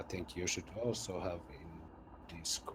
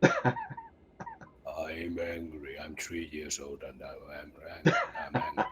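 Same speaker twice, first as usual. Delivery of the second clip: imitating an angry three-year-old, slow and louder; angry toddler; 3 semitones lower, 6 dB louder than usual. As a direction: stomp it out like a sulking little kid. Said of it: "like my son when he gets angry".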